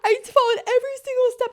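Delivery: high, loud mock-crying complaint; tearful outburst. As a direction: re-enact losing it, wailing in protest. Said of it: "I'm, like, crying".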